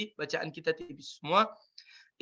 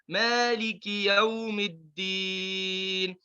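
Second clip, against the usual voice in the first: slow, chanted Maliki Yaumiddin; Quran recitation; much slower than usual. Shown as a demonstration. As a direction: recite it slowly and melodically. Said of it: "Jadi kita baca Maliki Yaumiddin".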